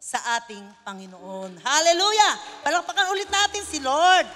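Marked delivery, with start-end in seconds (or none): 1.6-4.3 loud ringing praise call; shouted hallelujah. Real, not acted. Preacher shouting praise to the congregation, loud. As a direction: cry it out to the crowd.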